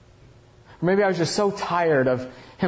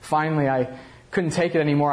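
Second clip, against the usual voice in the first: ordinary delivery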